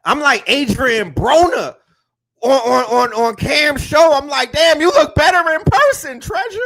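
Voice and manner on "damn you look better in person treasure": high, loud retold amazement; amazed compliment. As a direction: gush at someone seen in person. Retells his amazed greeting in person.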